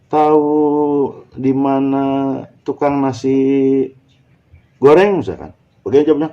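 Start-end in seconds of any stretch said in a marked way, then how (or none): 0.0-5.2 higher-pitched, halting question to a stranger; polite inquiry. Acted. Passer-by asking a youngster for fried rice seller, polite inquiry. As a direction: slowly ask the way, pausing between phrases.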